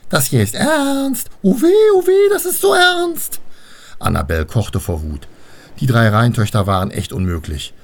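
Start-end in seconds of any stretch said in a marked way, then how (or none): 0.1-3.4 very high, drawn-out taunt; mocking mimicry. A narrator's reading. Rhine daughters ape Annabel, squealing mockery. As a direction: ape her in a loud, slow squeal. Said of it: "äfften Annabel nach".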